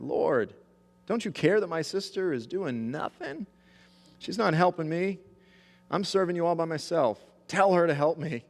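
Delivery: high, pleading complaint as Martha; upset Martha. Retold or imitated. Martha complaining to Jesus about her sister, high upset plea. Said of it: "she's all upset".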